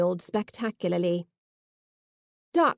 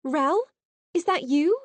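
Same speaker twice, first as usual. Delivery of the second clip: incredulous; 7 semitones higher than usual. Acted. Recognising her old friend.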